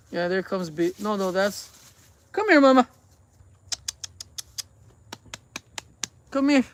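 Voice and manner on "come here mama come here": high, raised-voice pet call. Real, not acted. Owner coaxing a cat over, calling out. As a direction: beckon a cat to come over.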